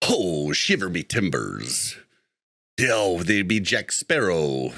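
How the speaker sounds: slow, drawn-out pirate voice. Shown as a demonstration. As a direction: put on a slow pirate growl for contrast. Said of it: "You know, that's a pirate".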